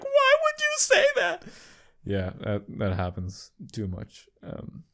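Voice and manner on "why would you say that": very high imitated girlfriend wail; mock tearful. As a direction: mimic an upset girlfriend, way up high. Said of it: "And then she'll cry".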